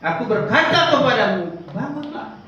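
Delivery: put-on loud, slow command to the girl; Jesus calling out. Jesus commanding the dead girl to rise, loud and slow. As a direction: call her up from death, slow and strong. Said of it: "Terus katanya".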